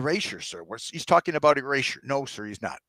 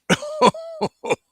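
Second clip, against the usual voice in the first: a strange high alien laugh, much higher; eerie high-pitched laugh; 7 dB louder than usual. Acted. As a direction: cackle up high and odd.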